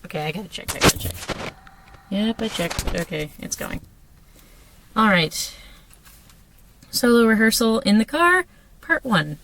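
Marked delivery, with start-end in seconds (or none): none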